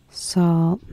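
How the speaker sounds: normal talking voice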